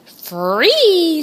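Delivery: high, loud emphatic cry; joyful cry. Acted. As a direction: stretch it out with joy. Repeating to stunned hens.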